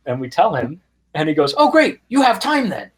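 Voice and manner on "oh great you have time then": a higher, lightly voiced eager superfan; eager fan voice. Fan telling his passengers they have time, lightly voiced eager. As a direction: pitch it up like the excited local fan.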